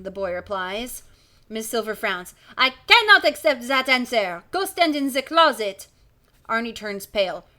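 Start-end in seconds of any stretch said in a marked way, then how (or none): 2.6-5.9 put-on strict teacher with a French accent, louder; stern French schoolmistress greeting the class. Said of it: "She's French as well".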